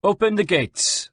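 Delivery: high, slow, measured command; officer's order. A narrator's reading. Captain instructs his sergeant, measured command. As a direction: give the order to a subordinate. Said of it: "said to his sergeant".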